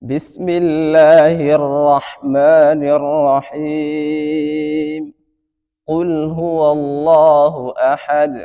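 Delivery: slow melodic tajweed chant with long held endings; Quranic recitation. Shown as a demonstration. Teacher recites Surah Fatiha for the class, chanted. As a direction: sing it out as measured Quran recitation.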